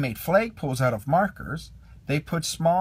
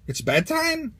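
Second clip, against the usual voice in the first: much higher surprised question; Ham surprised; 11 semitones higher than usual. A narrator's reading. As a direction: disbelief rising up high. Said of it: "Ham asked".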